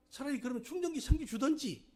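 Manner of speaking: high, loud imitation of a cross wife; retold angry retort. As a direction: throw the blame back, acting indignant. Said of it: "막 화를 내는 거예요". (화난 아내를 흉내 내며 높게 쏘아붙이듯)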